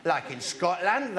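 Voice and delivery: ordinary talk, nothing marked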